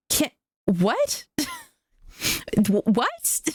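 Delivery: high, incredulous stammered what; stunned, can't believe it; baffled disbelief. Retold or imitated. Baffled by the video's ending.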